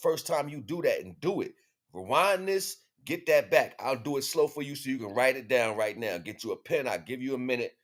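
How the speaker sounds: ordinary talk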